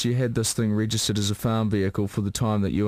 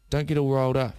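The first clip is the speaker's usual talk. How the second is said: ordinary talk, nothing marked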